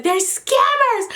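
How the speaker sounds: high yelled quote, drawn out; replaying an angry outburst; mock angry yell. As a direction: yell it like you're fed up. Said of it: "I said".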